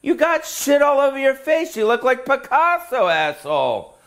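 mock heckler. Mocking the tattooed face.

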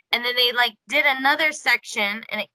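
ordinary talk, nothing marked

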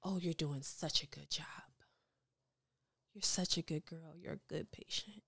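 whispered, soothing praise; hushed dentist voice cooing at his patient; dentist's soft praise. Retold or imitated. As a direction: murmur it like a gentle dentist. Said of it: "the dentist is like".

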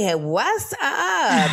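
slow, drawn-out hey what's up; playful slow hello to listeners; mock greeting. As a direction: stretch the greeting out lazily. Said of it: "forgot to say".

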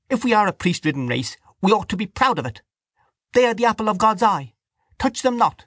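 high-pitched indignant woman; angry interruption. A narrator's reading. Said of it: "Dante broke in angrily".

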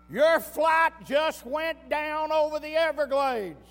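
loud, high, frantic yelling of terrible news; urgent shout. Acted. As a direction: shout it at him like the plane just fell. Relative shouts news of the crash.

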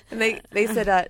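normal talking voice

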